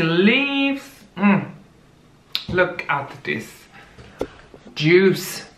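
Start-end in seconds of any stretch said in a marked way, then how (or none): none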